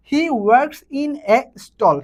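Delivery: very slow, each English word spaced out; slow dictation. Shown as a demonstration. Teacher dictating an example sentence to students, very slow. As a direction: read it out word by word for the class. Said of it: "teacher reads example sentence".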